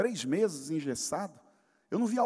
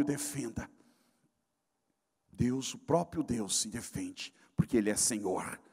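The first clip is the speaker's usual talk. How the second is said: low, soft, solemn declaration; hushed preaching; 10 semitones lower, 7 dB quieter than usual. Acted. Pastor quietly assures the church God needs no defense. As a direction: drop it down to a grave hush.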